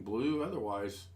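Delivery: usual speaking voice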